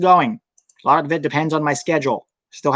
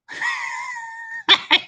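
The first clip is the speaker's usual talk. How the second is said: burst of laughter; 3 dB quieter than usual. Real, not acted. Cracks up at own story.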